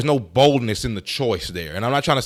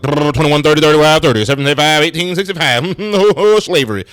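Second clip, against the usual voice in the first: raised, rattled-off auction numbers, lightly voiced; mock auctioneer; 4 semitones higher, 5 dB louder than usual. Commentator mocks the auction narrator as a fast-talking auctioneer. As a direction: play a hammed-up auctioneer calling bids. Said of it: "speak like the auctioneers speak".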